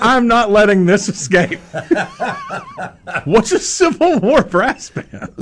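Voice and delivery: high-pitched, cracking up between sentences; laughing disbelief. Real, not acted. Interviewer pouncing on guest's Civil War band remark, laughing incredulously. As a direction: demand an answer through real laughter.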